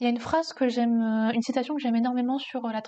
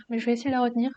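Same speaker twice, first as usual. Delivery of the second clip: ordinary talk, nothing marked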